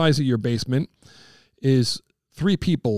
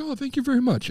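light mock actor; 11 semitones higher than usual. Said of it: "And he's like".

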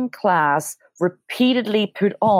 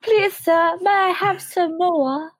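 high, slow pleading orphan voice; Victorian orphan; 11 semitones higher, slower than usual. Acted. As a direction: beg like a starving street urchin. Victorian orphan asking for more.

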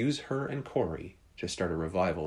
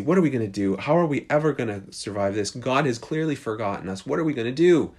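louder, higher, despairing questions; frantic, building to the last cry; panicked; 5 semitones higher, 6 dB louder than usual. Retold or imitated. Corey tells Betsy God has forgotten them, panicked. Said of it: "Corey is freaking out".

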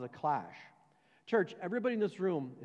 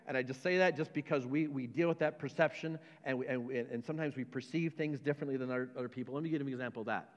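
ordinary talk, nothing marked

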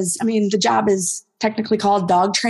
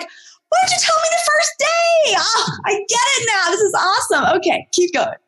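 much higher, thrilled realisation; excited dog voice; 13 semitones higher than usual. Retold or imitated. Trainer voicing a dog thrilled to understand, much higher. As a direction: play the dog who finally gets it.

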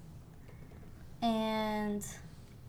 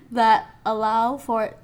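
laughing; 2 semitones higher, 10 dB louder than usual. Real, not acted. Laughs at her own stumble.

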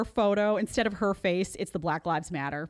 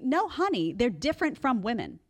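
ordinary delivery

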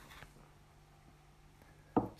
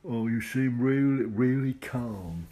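loud, high, drawn-out mock Cockney; hammed-up amateur Cockney, slow and loud; bad Cockney accent; 4 semitones higher, 8 dB louder, much slower than usual. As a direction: put on a Cockney accent badly, stretching the words. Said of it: "I can't do a Cockney accent".

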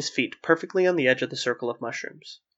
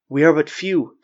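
louder, insistent plea; protesting; 3 semitones higher, 7 dB louder than usual. A narrator's reading. Wisps pleading with the watcher, protesting. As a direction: protest your case. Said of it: "the wisps protested".

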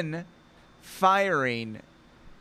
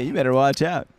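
ordinary talk

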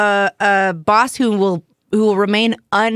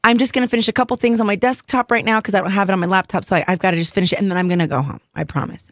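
hurried excuse; 4 semitones lower, faster than usual. Retold or imitated. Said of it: "I said".

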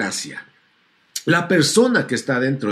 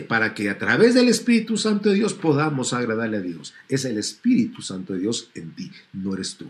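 ordinary talk

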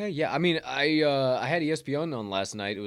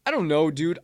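ordinary talk

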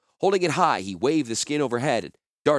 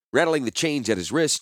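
plain reading voice

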